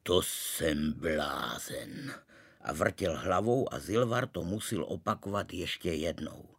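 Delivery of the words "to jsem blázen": very slow, low disbelief; dumbfounded, drawn-out and low; long drawn-out voice. A narrator's reading. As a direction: stretch every word out, pitched down. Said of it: "pravil dlouhým hlasem".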